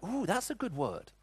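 high, slow, drawn-out ooh of delight; imitating the hairdresser's pleased surprise. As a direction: savour the word like a treat. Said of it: "She just went".

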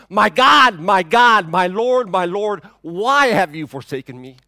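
anguished cry. Acted. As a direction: cry out in abandonment, fading into the final question. Jesus crying out on the cross.

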